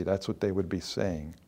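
ordinary delivery

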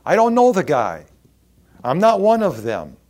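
loud, high, frantic protest; loud denial. Acted. Peter denying Jesus to his accusers, loud and frantic. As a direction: shove the accusation away hard.

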